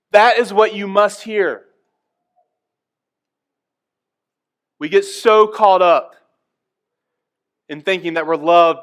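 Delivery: loud, high, driving sermon voice; full-force preaching. Acted. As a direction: preach it out to the congregation.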